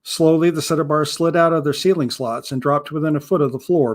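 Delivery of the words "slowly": plain reading voice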